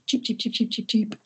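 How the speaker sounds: rapid high cheeping, like a hungry brood; baby chick peeps. Retold or imitated. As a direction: chirp cheep fast and high. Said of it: "at a fantastically fast rate".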